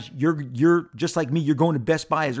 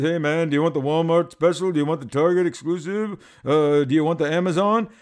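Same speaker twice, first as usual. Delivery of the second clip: lightly voiced laid-back toy dealer, a touch louder; toy dealer impression; 4 dB louder than usual. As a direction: slip into the dealer's easygoing pitch, only a little put on. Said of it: "He talks like this".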